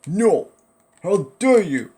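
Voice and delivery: high loud outraged yell; shaking with anger. Pretend. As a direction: shout it in mock fury. Outraged cry at game event.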